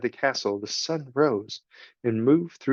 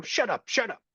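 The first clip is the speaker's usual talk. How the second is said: higher squeaky rat, hurried; frantic rat; 10 semitones higher, 4 dB quieter than usual. Acted. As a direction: panic to stop the words. The rat panics.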